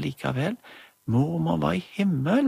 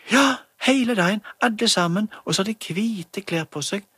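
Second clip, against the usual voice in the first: much higher, louder insistent answer; eager child; 7 semitones higher, 3 dB louder than usual. Acted. Emma explains heaven to friends, high and eager. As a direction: insist like a know-it-all kid.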